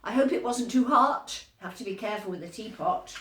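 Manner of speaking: ordinary delivery, nothing marked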